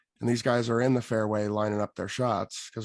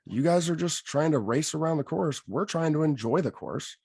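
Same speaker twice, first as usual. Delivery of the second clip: annoyed rant; 6 semitones higher than usual. Retold or imitated. Annoyed rant at slow group.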